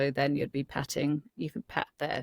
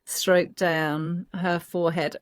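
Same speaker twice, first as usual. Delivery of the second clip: ordinary delivery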